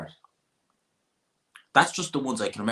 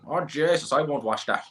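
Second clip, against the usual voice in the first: high dismissive Irish country voice; hammed-up Midlands local; mocking rural Irish accent; 6 semitones higher than usual. Local dismisses a Dublin fight card, high Irish accent. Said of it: "That's what they're like".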